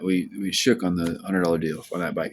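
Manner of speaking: ordinary delivery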